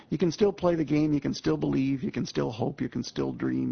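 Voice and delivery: ordinary talk, nothing marked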